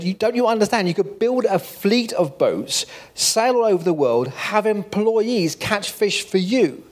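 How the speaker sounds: high, exasperated retort, slowing down; a hint of an angry businessman losing patience. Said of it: "got angry and said".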